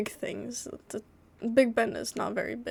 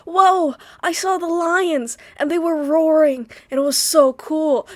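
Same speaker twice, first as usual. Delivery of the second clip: put-on high, loud, wide-eyed child voice; excited kid; 5 semitones higher, 8 dB louder than usual. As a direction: gush like a kid just back from the zoo. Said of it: "I feel like a kid who came back from the zoo".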